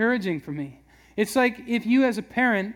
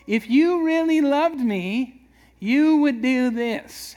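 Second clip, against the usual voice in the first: drawn-out, higher, louder whiny plea; guilt-trip plea; 5 semitones higher, 5 dB louder, slower than usual. Pretend. Husband guilt-tripping his wife for affection, whiny slow plea. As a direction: lay on the guilt slowly, pleading. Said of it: "How many have ever pulled that".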